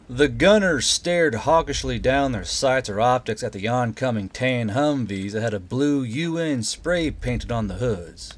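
ordinary delivery, nothing marked

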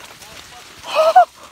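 high gasp at icy water; sharp gasp from cold. Real, not acted.

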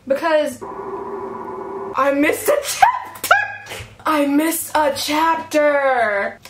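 slow, drawn-out wail of disbelief; real shock, dragged out word by word; dismayed outburst. Real, not acted. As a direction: stretch each word out in dismay. Discovers she skipped a chapter.

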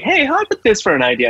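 high, light mock pitch; [name] pitching an idea. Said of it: "'cause John, I was like".

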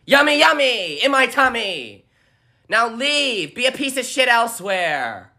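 high, loud, unhinged screaming threats; shrieking mock rage at a debater; violent yelling. Shown as a demonstration. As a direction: scream it like a feral maniac. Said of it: "really violently, this is how I do it".

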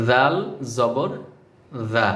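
জাল জবর জা with the tongue tip on the upper front teeth; careful pronunciation. Shown as a demonstration. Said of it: "জিহ্বাটা লাগিয়ে".

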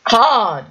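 "hard" punched high and loud; stress on "hard". Real, not acted. Player protesting to doubting viewers, "hard" loud and high. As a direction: hit "hard" with a loud, high falling cry.

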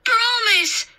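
very high, loud plea; urgent little-boy cry; desperate child's demand. A narrator's reading. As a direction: insist on it like a panicky boy.

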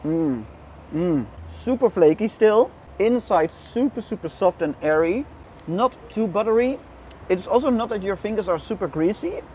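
chewing while talking; talking between bites; mouth full. Real, not acted. Seen 0: bites at -3, cheeks full and chewing while speaking.

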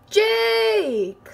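high call across the house. Real, not acted. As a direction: call him over. Calling brother to come.

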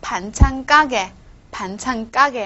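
"嘎" in "盘灿嘎给" hit as a hard tense k, slow and loud; tensed consonant. Shown as a demonstration. Teacher showing students how it is read, "嘎" in "盘灿嘎给" tensed hard. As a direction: say "盘灿嘎给" slowly, "嘎" heavy and tensed. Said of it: "要变成重音的".

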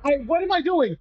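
panicked. Real, not acted. Panicked self-reproach.

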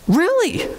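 high-pitched incredulous question; amazed. Retold or imitated. Preacher retelling learning that Gina reads Greek, amazed. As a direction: react as if you can hardly believe it.

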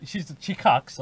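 normal talking voice

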